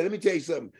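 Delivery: normal talking voice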